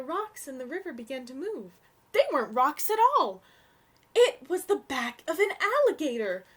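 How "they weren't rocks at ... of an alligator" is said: dramatic reveal. A narrator's reading. Suspense reveal in story.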